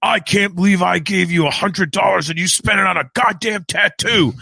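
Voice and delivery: high, strained mock scream; imitating furious dad. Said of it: "screaming at me damn near".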